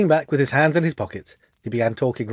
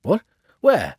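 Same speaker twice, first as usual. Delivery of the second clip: sudden sharp cry, louder; startled shout; 6 semitones higher, 5 dB louder than usual. Acted. Trapped Windibank crying out as Holmes locks the door, startled shout. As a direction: blurt it out in alarm. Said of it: "shouted Mister Windibank".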